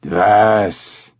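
guttural orangutan bark of a word; throaty animal utterance; cough-like ape sound. A narrator's reading. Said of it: "made an odd sound, like a cough".

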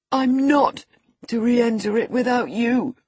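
high, loud, panicky wail; frightened insistence. A narrator's reading. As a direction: cry it out in desperate fear. Terrified boy begs cousin to come.

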